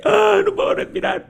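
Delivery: high, gasping panic; panicked gasp. Retold or imitated. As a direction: act out losing your breath. Acting a panic attack. (pánico, sin poder respirar)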